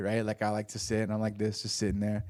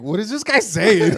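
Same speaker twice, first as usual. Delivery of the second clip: higher, louder baffled voice; mock confused Korean; 7 semitones higher, 7 dB louder than usual. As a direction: play the puzzled local. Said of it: "they're like".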